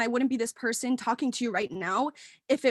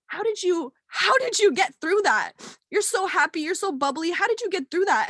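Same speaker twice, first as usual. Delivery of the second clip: higher, amazed friends' voices; imitating friends; 6 semitones higher, 3 dB louder than usual. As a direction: lightly mimic astonished friends asking. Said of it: "they go".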